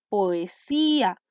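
POESÍA slowly, split as po, e, sí, a; teacher sounding out POESÍA as po-e-sí-a; syllable by syllable. Shown as a demonstration. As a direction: draw out each syllable of POESÍA. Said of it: "sílabas distintas".

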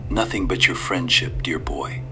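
soft sly whisper; whispered old man. Acted. Old stranger to the boy, sly whisper. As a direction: breathe it out slyly.

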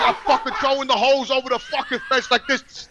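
normal talking voice

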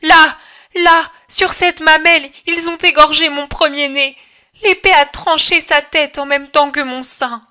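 high, loud anguished howl; howling mother. Acted. Mutilated mother howling her dead to Jesus, high anguished shout. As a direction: hurl your grief at the Saviour. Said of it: "et hurle".

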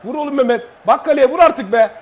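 high, loud urging yell; shouting at player. Real, not acted. Gamer yelling at his striker Mehmet to shoot, loud. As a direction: yell at him to just shoot.

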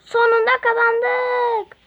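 high loud victory cheer. Acted. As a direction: cheer the win as a character.

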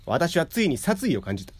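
ordinary delivery, nothing marked

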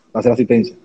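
usual speaking voice

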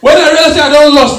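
loud, high laughing burst; laughing loud realization; loud laughing outburst. Real, not acted. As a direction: blurt it out laughing in disbelief. Panic on realizing lost.

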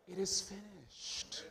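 nearly breathed, slowly; hushed. Acted. Preacher repeating Jesus' words to the congregation, hushed.

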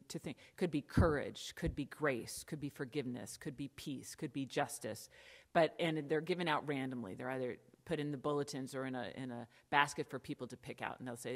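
ordinary talk

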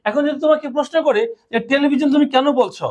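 ordinary talk, nothing marked

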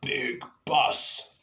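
lightly grunted; caveman voice. Acted. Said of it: "Og is saying up there".

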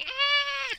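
mock scream. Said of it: "Why he's going".

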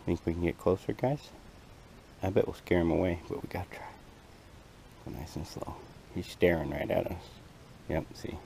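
hushed, creeping closer to a butterfly; wildlife watching whisper. Real, not acted. Sneaking up on a butterfly.

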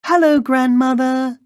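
put-on very high, loud little-girl call. A narrator's reading. Girl calls at grandmother's door, high and loud. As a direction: call out in a piping child's voice. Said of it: "she called".

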